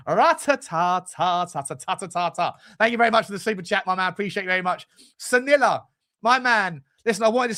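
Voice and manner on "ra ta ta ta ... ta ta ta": playful rat-a-tat chant. Real, not acted. Playful noise after excitement.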